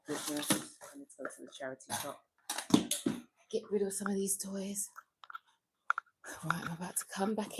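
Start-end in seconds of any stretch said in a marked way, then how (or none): 3.5-7.6 quick whispered aside. Real, not acted. Heading back to her live viewers, whispering. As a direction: murmur it voicelessly, hurrying. Muttering off-mic in other room.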